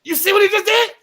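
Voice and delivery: high, loud, cracking up; laughing disbelief. Real, not acted. Pointing out a friend's outburst to the others, laughing hard. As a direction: blurt it out through real laughter.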